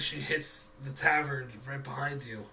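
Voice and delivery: usual speaking voice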